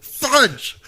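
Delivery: high-pitched cries of mock rage; hammed-up screaming. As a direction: scream each curse, carried away. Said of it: "Sorry for screaming in your ear".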